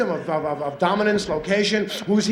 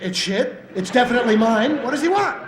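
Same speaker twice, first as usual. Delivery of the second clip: rising higher, puzzled dog; confused dog voice; 6 semitones higher than usual. Retold or imitated. Dog defending his spot under the piano, higher and puzzled. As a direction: play the baffled dog. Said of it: "And he goes".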